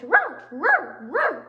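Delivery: loud imitated yapping; tiny dog yapping on and on; squeaky puppy bark. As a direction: bark in sharp little bursts. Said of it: "tiny, squeaky voice".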